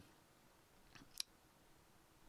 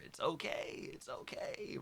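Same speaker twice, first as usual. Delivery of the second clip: soothing; 6 semitones higher, 6 dB quieter than usual. Pretend. Comforting his past self.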